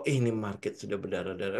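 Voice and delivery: low, soft imitation of a scared retail trader; mock panicked trader. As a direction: mimic a panicking retailer quietly. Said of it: "pada saat lu berkata bahwa". (meniru trader ritel yang panik, suara rendah dan pelan)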